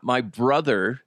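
normal talking voice

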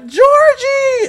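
very high shrieked name; excited squeal. Retold or imitated. Girls at the door squealing her name in delight.

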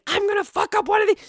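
high, fast retold phone yell; imitating an angry husband yelling down the phone, fast and high; mock yell. Husband yelling at Robin on the phone, high fast mock yell. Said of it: "he is yelling on the phone".

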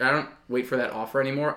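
normal talking voice

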